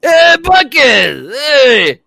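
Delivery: high, drawn-out holler; mock movie yell to a dog, loud and high; calling out. Quoting Stallone calling his movie dog.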